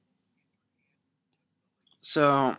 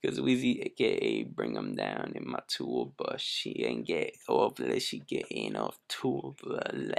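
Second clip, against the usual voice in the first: [name] voice; 12 semitones lower, 4 dB quieter than usual. Pretend. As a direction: sink deep into a slurred rapper voice. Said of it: "I should do the rest of this like [name]".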